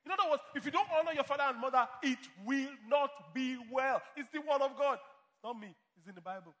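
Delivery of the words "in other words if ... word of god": louder, higher, stressing NOT and don't; loud preaching. Real, not acted. Pastor warning children who dishonor parents, shouted. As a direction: thunder the warning at the congregation.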